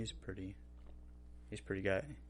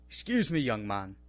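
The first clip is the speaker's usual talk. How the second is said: lightly mimicking chef; 4 dB louder than usual. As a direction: gently scold a grown man like a chef. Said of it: "he'll be like".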